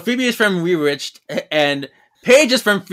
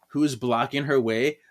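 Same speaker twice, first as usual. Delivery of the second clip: ordinary delivery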